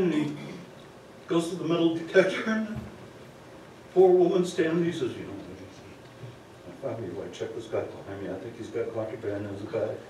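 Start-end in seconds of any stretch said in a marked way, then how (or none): 5.1-10.0 quiet. Retold or imitated. Doctor snitching quietly.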